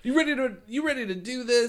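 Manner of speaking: hype call. Acted.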